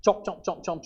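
rhythmic mock chewing noise; imitated chomping. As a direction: lightly mimic mindless munching, chomp after chomp. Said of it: "You don't just chomp".

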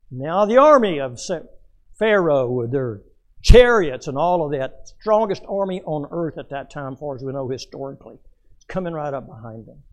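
loud, high preaching on the chariots; booming pulpit storytelling; preaching at full force. Acted. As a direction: build it up loud like a revival preacher. Preaching Pharaoh's army scene.

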